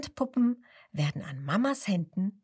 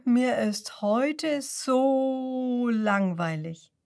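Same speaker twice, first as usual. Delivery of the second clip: langsam und gedehnt, gelangweilt; gelangweilt seufzend; 4 semitones higher, much slower than usual. A narrator's reading. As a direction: die Worte träge ausseufzen. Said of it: "seufzt Seppel". (Seppel klagt Kasper seine Langeweile, sehr langsam)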